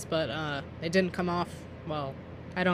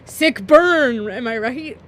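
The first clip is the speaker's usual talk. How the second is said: loud, higher hype-man cheer; mock hype; 5 semitones higher, 10 dB louder, slower than usual. Hyping her own comeback to the boy, mock hype. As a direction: crow over a burn, loud and high.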